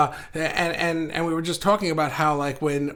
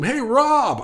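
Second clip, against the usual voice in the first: higher, louder call of Hey, Rob; calling across a room; 8 semitones higher, 4 dB louder than usual. Retold or imitated. Someone yelling across the room to get his attention, calling out. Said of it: "if you yell across the room".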